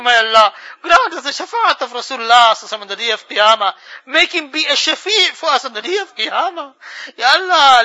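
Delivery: usual speaking voice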